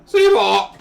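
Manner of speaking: very loud, high garbled holler; exaggerated imitation of a botched shout; mimicked odd call. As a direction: bellow the name, mangled, mimicking the caller. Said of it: "is what approximately comes out".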